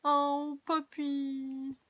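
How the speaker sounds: high, drawn-out coo; cooing over a puppy. Real, not acted. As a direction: gush sweetly, stretching the last vowel.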